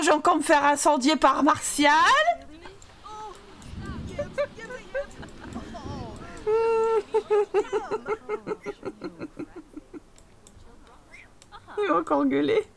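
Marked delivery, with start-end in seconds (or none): 3.7-10.0 long, high, soft laugh; laughing fit. Real, not acted. Player amused at Martial's reaction, long high laughter. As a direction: laugh helplessly and let it trail on.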